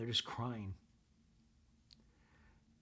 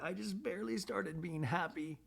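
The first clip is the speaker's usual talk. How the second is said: imitated tearful protest, much higher pitched; retold crying; 9 semitones higher than usual. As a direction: lightly mimic a crying son in a high voice. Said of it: "And he's crying".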